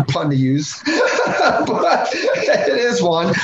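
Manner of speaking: breaking into high laughter mid-sentence; real giggle over a pun; laughing. Real, not acted. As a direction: laugh through the words at your own bad joke. Laughing at his own pun.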